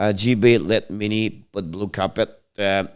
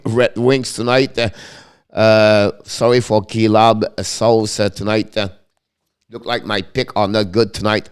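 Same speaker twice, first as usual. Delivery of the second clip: upbeat score roundup, a bit higher and louder; 4 semitones higher, 8 dB louder than usual. Acted. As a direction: run through the other games. Around-the-league score update.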